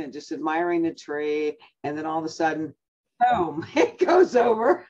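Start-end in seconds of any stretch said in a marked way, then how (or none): 3.2-4.9 laughing through the word boom, slow. Real, not acted. Telling friends the Christmas tree fell, laughing. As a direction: crack up as you tell it.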